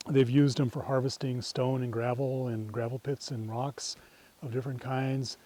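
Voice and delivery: normal talking voice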